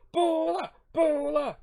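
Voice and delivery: high, loud cheer; mock rallying cheer. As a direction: whoop it like a team chant. Mock cheer after a pep talk.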